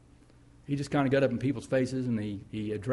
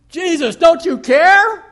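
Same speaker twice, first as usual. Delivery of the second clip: a frantic, high shout at full voice, slowed; desperate cry; 16 dB louder, slower than usual. Acted. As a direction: cry out to Jesus in panic, fighting for your life. Disciples panicking in the storm.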